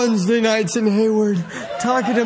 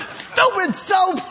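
slow laughing exclamation, higher pitch; 9 semitones higher, slower than usual. Real, not acted. Preacher cracking up over the soap scheme, laughing.

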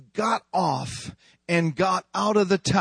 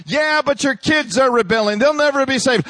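devil taunt; 6 semitones higher, 4 dB louder than usual. Acted. Devil's retort in dialogue.

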